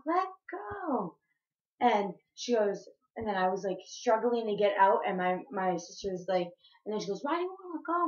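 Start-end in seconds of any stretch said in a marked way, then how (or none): 0.1-1.2 high, drawn-out mock wail; crying plea. Crying niece pulling away from aunt, high wail. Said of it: "she saw me crying". 7.2-8.1 high-pitched retold demand; mocked challenge. Aunt questioning struggling niece, high-pitched challenge.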